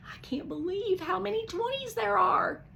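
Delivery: usual speaking voice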